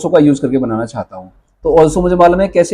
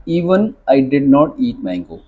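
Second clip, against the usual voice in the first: धीमे साफ़ शब्द; slow model sentence; 4 semitones lower, 3 dB quieter, much slower than usual. Shown as a demonstration. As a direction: dictate slowly. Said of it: "even का use करके बनाना चाहता हूँ". (धीमा उच्चारण)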